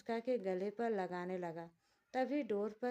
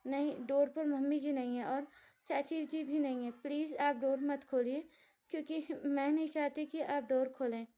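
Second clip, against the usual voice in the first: higher, louder, urgent plea; frightened pleading; 5 semitones higher, 4 dB louder than usual. Acted. The wife begs again so Lakshya won't open the door. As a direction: insist out of fear that he keep the door shut.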